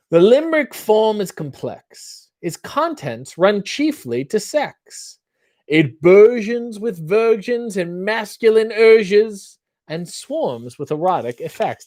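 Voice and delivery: lightly put-on posh British accent, reciting; an American pretending to be British; mock British theatre accent. Reading limericks to the chat in a mock British accent. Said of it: "what is that accent you're doing".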